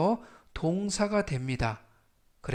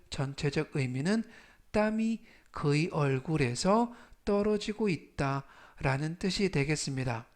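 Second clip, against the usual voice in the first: ordinary talk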